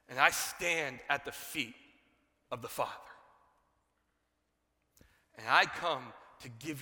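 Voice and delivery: slow, weighty pronouncement with long pauses; Gabriel. Acted. Angel answering doubting Zechariah, slow and solemn. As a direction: declare it solemnly, hitting the opening word loud. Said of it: "He looks at him and he goes".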